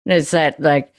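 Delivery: usual speaking voice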